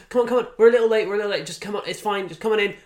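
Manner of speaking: imitating a comic hustling latecomers in, loud and high; calling people in. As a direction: loudly wave the crowd in. Mark Watson ushering audience in.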